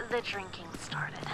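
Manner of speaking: soft whisper, slow and heavy; whispered confession. Acted. Confiding to a friend about his grandfather, hushed whisper. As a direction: breathe the words out like a painful secret.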